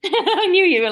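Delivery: laughing. Real, not acted. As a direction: burst out laughing mid-line. Laughing at partner.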